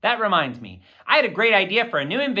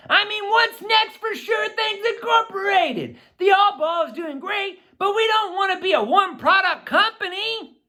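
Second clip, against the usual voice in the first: put-on higher, eager boyish friend voice; Manny's voice. A narrator's reading. Manny telling his partner about school, eager higher boy voice. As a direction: play the excited business partner, pitched up.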